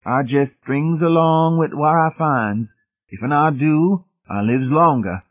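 high, slow mock Southern dialect; burlesque dialect. A narrator's reading. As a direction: drag out a bitter parody of servile dialect. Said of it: "Laws burlesqued".

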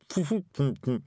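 a high cackling wee laugh; Feegle laugh. A narrator's reading. Daft Woolly laughs at his joke, high chuckle.

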